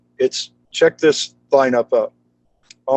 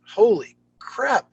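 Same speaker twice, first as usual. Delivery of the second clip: amazed aside; 9 semitones higher, 3 dB quieter, slower than usual. Retold or imitated. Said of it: "but I'm like".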